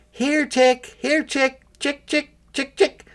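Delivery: high, sing-song call to the chicks. A narrator's reading. Girl calling chicks.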